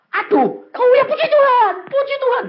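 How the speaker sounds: high, loud mock cry; pained praise. Woman praising God despite bellyache, acted high cry. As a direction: wail praise through acted pain.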